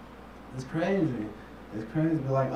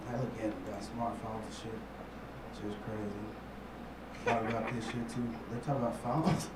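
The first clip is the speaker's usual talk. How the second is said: usual speaking voice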